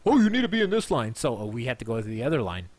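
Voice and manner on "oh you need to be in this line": high, bright put-on staff voice; mock staff voice. Retelling the usher at the VIP line, a higher put-on voice. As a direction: chirp it like a cheerful usher.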